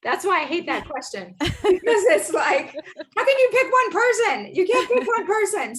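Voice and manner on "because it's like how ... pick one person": laughing. Real, not acted. Host laughs, exasperated at the question.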